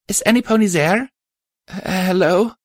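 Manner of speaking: tiny, high filly voice; very high, small character voice. A narrator's reading. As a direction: call out hesitantly as a little lost filly. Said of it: "a tiny voice called out in the darkness".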